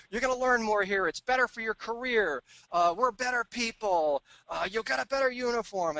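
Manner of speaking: high, loud imitation of pushy recruiters; high-pitched parody of eager sellers; mock sales pitch. Mocks owners' eager sales pitches.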